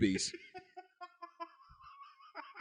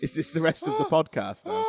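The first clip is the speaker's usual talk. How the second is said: laughing shout; 6 semitones higher, 5 dB louder than usual. Real, not acted.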